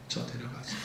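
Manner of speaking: worn-out soft plea to God; faint plea. Acted. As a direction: fade 저 데려가세요 to a weary murmur. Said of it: "하소연하면서 기도합니다".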